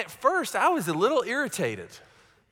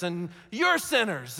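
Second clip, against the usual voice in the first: slowed mocking accusation; slower than usual. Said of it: "go around saying".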